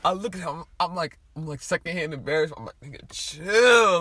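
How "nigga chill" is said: slow, loud, drawn-out plea; mock exasperated. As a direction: stretch it out, embarrassed for him. Telling obnoxious friend to calm down.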